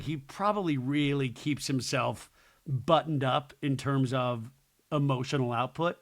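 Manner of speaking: normal talking voice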